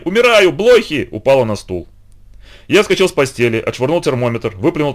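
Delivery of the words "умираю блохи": high, loud, slow dying cry; dramatic wail. A narrator's reading. Aunt collapsing onto the chair, melodramatic slow wail. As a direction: ham up a swoon of horror. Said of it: "И со словами".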